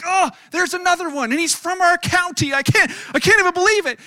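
high, loud indignant rant; exasperated outrage. Retold or imitated. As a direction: re-enact fuming disbelief at the news. Outraged reaction to news retold.

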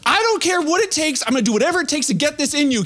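high, insistent mock resolve; determined parent. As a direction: play a parent who refuses to give up. Acted parent determined to feed kid.

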